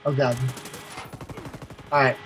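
ordinary talk, nothing marked

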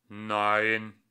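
a sulky machine squeaking through a speaker; piepsige, metallische Roboterstimme; squeaky metallic voice. A narrator's reading. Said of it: "tönte eine piepsige Stimme metallisch".

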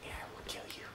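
breathy whispered threat; whispering. Acted. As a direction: hiss the threat under your breath. Trash talk whisper.

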